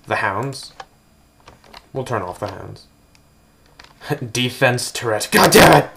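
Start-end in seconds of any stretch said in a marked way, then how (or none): 5.3-5.9 loud, high exclamation; real sudden yell of frustration; real frustrated outburst. Real, not acted. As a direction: blurt it out, genuinely startled. Startled by a defense turret.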